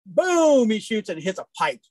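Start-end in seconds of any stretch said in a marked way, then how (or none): 0.1-0.7 loud, high, drawn-out boom; gunshot imitation. Storyteller mimics the hunter's shot, explosive.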